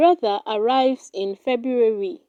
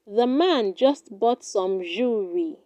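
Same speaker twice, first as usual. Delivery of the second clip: ordinary talk, nothing marked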